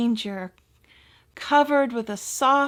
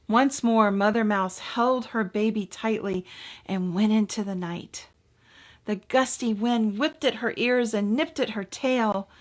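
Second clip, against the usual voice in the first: usual speaking voice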